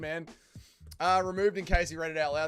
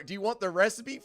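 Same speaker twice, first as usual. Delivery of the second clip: ordinary talk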